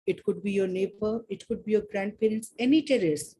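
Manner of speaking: calm guiding voice, easing them in; guided meditation. Acted. Leads a meditation.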